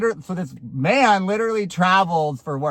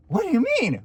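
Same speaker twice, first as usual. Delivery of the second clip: indignant protest; 12 semitones higher than usual. Retold or imitated. Retells her indignant reply.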